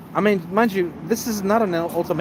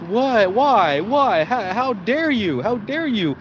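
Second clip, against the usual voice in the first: high, mock-outraged girlfriend voice, slowing on how dare you; 7 semitones higher, slower than usual. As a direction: play the offended girlfriend, pitched up. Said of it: "And the girl goes".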